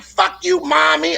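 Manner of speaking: put-on high bratty child voice; mock child sass; bratty kid. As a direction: spit it at mom like a brat. Child cursing at mother.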